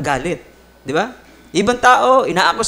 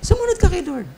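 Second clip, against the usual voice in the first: preaching emphasis; 9 semitones higher, 3 dB quieter than usual. Real, not acted.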